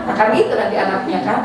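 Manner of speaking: ordinary talk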